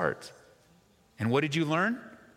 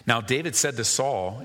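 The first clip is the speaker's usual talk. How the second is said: ordinary talk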